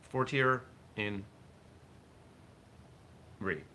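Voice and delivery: "fortier in re" slow and halting; butchered Latin. Real, not acted. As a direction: stumble through "fortier in re" with a long pause before "re". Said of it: "I'm butchering this".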